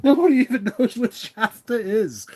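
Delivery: high, loud, helpless laughing speech; crying with laughter. Real, not acted. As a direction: squeal it out through real laughter. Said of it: "I'm literally crying, like, from laughter".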